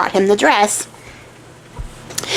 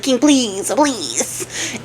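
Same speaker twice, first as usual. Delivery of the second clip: drawn-out plea dropping softer; mock pleading; 2 semitones higher, 4 dB quieter, much slower than usual. Archer begging the king for his life, mock pleading. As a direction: beg in a hammed-up way.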